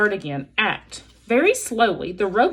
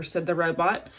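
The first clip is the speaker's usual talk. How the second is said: ordinary delivery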